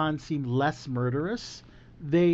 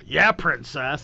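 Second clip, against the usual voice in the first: patronizing jab at a princess; condescending Han Solo; 3 semitones lower, slower than usual. Retold or imitated. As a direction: say it like a condescending smuggler. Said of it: "very condescending".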